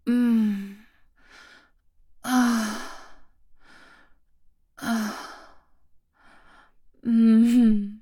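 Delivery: panting sighs. Acted.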